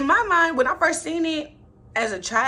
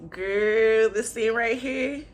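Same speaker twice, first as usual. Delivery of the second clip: ordinary delivery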